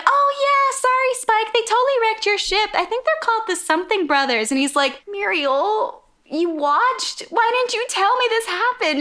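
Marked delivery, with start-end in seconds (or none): 0.1-4.5 put-on high, airheaded girl voice; dim-witted waitress. Dumb waitress telling Spike about his ship, high ditzy voice. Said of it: "she's very dumb". 5.1-9.0 high, exasperated mock whine; imitated whine. Spike asking the waitress why she didn't tell him, high whine. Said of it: "he literally whines".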